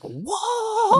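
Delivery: high, stretched-out whoa; a long squeaky wired whoa; mock whoa. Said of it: "you were like".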